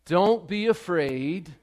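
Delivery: preaching Jesus's words. Acted. Preacher declaring Jesus's words to the congregation, slow and lifted. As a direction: proclaim it slowly to the whole room. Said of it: "And Jesus says this to you".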